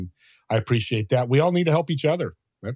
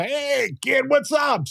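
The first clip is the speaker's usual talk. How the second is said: hearty call-out; 12 semitones higher, slower than usual. Retold or imitated. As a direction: holler like an old friend. Mentor answering the call.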